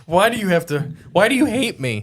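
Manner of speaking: high, whiny fake offense; mock hurt whine. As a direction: whine it like a wounded friend. Friend teased, acts hurt.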